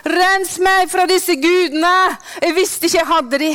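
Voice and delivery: preacher showing how to cry out in prayer; pleading prayer. Shown as a demonstration. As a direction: cry out to God for cleansing. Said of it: "Så kan du bare".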